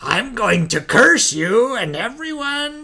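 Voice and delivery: high slow cursing ghost; hammy spooky curse; unearthly voice. Retold or imitated.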